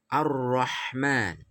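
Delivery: Ar-Rahman slowly, the lam merged into a doubled rr; slow recitation of Ar-Rahman with doubled r; correct tajwid. Shown as a demonstration. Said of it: "Tidak boleh dibaca Al-Rahman". (Ar-Rahman dibaca pelan, lam lebur ke ra)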